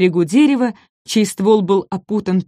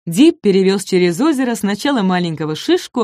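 plain reading voice

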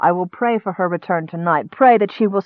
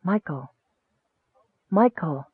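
hushed, softer calling voice; soft distant call; 7 dB quieter than usual. A narrator's reading. Goddess calling the sleeping monk by name, hushed. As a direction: call a sleeper gently, very quietly. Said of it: "roused again by the same call".

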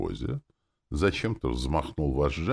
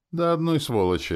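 high, loud spiteful retort; 14 semitones higher, 5 dB louder than usual. A narrator's reading. Demon snapping back at her host, spiteful. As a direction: spit it back with malice. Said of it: "ответила демон злобно".

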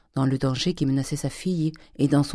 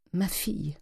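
softer, breathy whisper of a desperate mother; whispered plea; 4 semitones higher, 7 dB quieter, slower than usual. Acted. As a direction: barely breathe the words. Wife begs for daughter. (supplique murmurée d'une mère)

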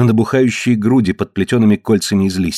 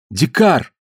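higher-pitched startled call; exclamation; 5 semitones higher than usual. A narrator's reading. Mary Lee cries out to Dikar, mild exclamation. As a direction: exclaim the name, without real shouting. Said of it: "воскликнула Мэри Ли".

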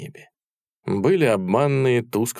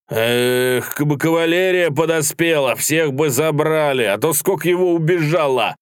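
loud regret; 7 semitones higher, 4 dB louder than usual. A narrator's reading. Soldier regretting the cavalry never came, high exclaiming. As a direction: cry out the missed chance.

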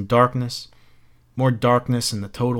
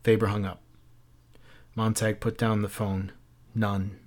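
usual speaking voice

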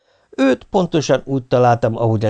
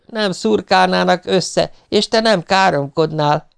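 high, drawn-out reproach; wife's retort; 7 semitones higher than usual. A narrator's reading. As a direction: reproach him with raised pitch.

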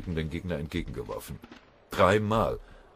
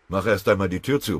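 plain reading voice

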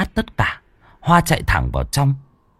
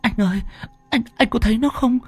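terrified stammer. A narrator's reading. Terrified woman describing corpse.

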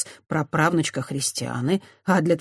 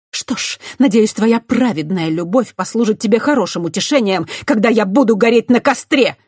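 much higher and louder, venomous; spat out; 6 semitones higher, 7 dB louder than usual. A narrator's reading. Christiana spitting a bitter farewell at the priest. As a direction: spit the words at him. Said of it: "выплюнула Христиана".

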